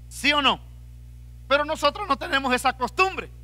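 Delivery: ordinary talk, nothing marked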